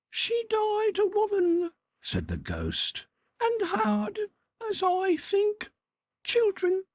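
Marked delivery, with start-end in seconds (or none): 0.1-1.7 very high, thin otherworldly voice; ghostly spirit voice. A narrator's reading. The Ghost tells Scrooge of his sister, high and otherworldly. 3.4-6.8 very high, thin otherworldly voice; ghostly spirit voice. A narrator's reading. The Ghost tells Scrooge of his sister, high and otherworldly.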